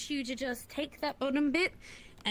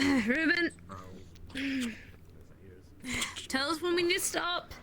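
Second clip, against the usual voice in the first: calling out; 3 semitones higher than usual. Real, not acted. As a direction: shout across while hauling. Shouting over the hauling effort.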